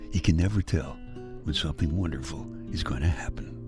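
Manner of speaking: trailing into a hushed whisper; soft wistful whisper. Acted. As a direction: let it fall to a whisper. Wistful close of intro.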